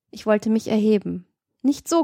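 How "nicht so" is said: higher-pitched, short command. A narrator's reading. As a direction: give the order with raised pitch. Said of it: "gebot sie". (gebieterisch, mit höherer Stimme)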